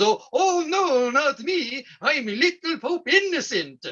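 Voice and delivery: hammed-up innocent whine; feigned innocence. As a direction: plead innocent like a sweet little pope. Pope housemate pleads innocence.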